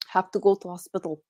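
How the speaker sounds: put-on movie line in a Russian accent. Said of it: "a Russian accent".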